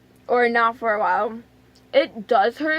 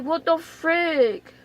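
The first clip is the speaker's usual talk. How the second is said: higher, very drawn-out complaint; exasperated disbelief; 4 semitones higher, much slower than usual. Retold or imitated. Retelling the waiting room shock, exasperated disbelief. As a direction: stretch every word in mock dismay.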